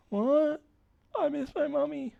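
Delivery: put-on very high, childish whimper; squeaky pitiful captive. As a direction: plead like a homesick little kid. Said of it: "this is the voice".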